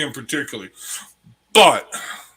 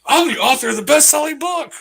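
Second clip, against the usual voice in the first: mocking the mom; 7 dB louder than usual. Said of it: "the mom from claiming that".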